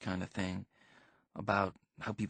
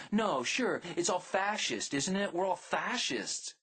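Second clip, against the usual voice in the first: higher mock-agreeing sarcasm, slowing at the end; sarcastic teenage comeback, pitched up; 9 semitones higher, slower than usual. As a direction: sneer it back at her as fake agreement. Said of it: "And I got sarcastic".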